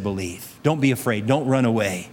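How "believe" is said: hushed, gentle single word; a quiet, tender "Believe."; soft. Retold or imitated. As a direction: drop almost to a breath on "Believe.". Jesus quoted, said softly.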